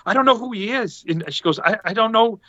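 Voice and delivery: high, mock-timid I don't know; reluctant wife. Wife refusing photo.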